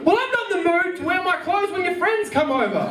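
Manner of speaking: high mocking girlfriend voice. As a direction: parody her complaint in a high voice.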